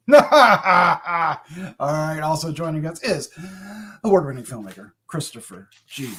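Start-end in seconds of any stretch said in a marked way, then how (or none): 2.2-6.1 hushed introduction. Pretend. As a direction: let the name sink down into a whisper. Mock-solemn introduction fading to whisper.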